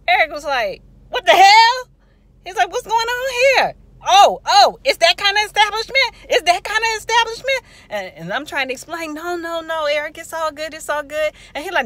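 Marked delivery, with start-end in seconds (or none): 1.1-1.9 very high, loud mock yell; pissed-off brother. Brother sees his sister walking, pissed off. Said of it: "So Eric was pissed". 2.7-7.6 high, loud indignant outburst; pissed-off brother. Retold or imitated. Brother accuses hospital over the wheelchair, pissed off. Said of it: "So Eric was pissed". 9.1-11.3 high, louder hurried placating; pleading retold reassurance. Woman explains to angry brother, pleading.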